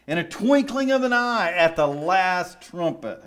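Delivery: preaching scripture. Acted. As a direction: read it like a preacher lifting the church. Reads scripture with rising fervour.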